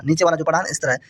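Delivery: usual speaking voice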